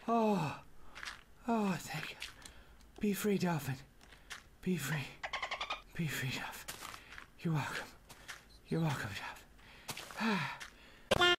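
soft, low sighs of relief; relieved, hushed. Real, not acted. Player setting the dolphin free, soft relief. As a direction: drop to a near whisper, spent and tender.

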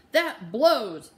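loud sulky complaint; annoyed outburst. Acted. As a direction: spit it out in frustration. Oliver angry, kicks wall.

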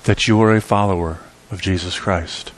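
ordinary delivery

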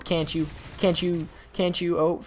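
ordinary talk, nothing marked

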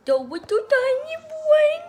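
very high, loud squeal; squeaky high voice. Pretend. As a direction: squeal it in a tiny voice. Sudden squeaky cry voice.

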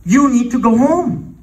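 high, loud, slow command; Holy Spirit's command. Retold or imitated. Holy Spirit to a praying composer, commanding. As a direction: tell them firmly and loudly, stretched out. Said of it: "what the Holy Spirit impressed on me".

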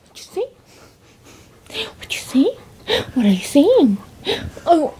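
high whispered pet talk. Real, not acted. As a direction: coo questions to the puppy. Baby talk to dog.

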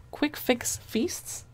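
"Quick" stretched out for emphasis. Real, not acted. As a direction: lean on "Quick", drawing it out slowly. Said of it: "Emphasis on quick".

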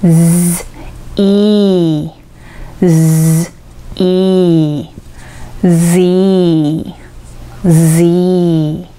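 Z split into z and E, then said whole; Zを分けてから通して発音; Z built from parts. Shown as a demonstration. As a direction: buzz z, add E, then blend into Z. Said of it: "少し前に持ってきて押し出す感じで".

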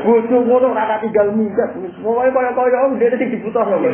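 high, loud back-and-forth; heated mock argument. Preacher acting out a quarrel over a runaway wife, high and loud. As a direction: bark it like two men quarrelling.

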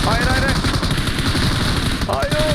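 loud, rising cries of no; panicked shouting. Real, not acted. Gamer yelling no at the screen, panicked shout. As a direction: yell no as the fight goes wrong. Losing a fight in game.

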